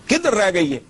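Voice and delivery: normal talking voice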